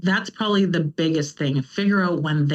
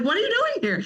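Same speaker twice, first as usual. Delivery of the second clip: high, incredulous squeak of surprise; mock-startled, pitched way up; retold shock; faster than usual. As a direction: caught off guard, voice jumping high. Startled by son sneaking up.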